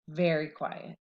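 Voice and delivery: hushed suspense; hushed stress on very. A narrator's reading. Suspense repeated, lower.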